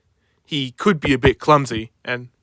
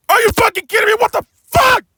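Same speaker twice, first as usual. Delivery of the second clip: alarmed shouting; 5 dB louder, faster than usual. Acted. Crane swinging.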